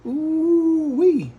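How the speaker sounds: high, stretched-out "ooh-wee"; drawn-out cry of relish; delighted whoop. Real, not acted. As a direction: whoop in admiration of the food. Admiring the cheesy hot dogs.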